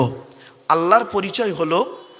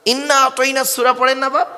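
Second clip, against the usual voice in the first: ordinary talk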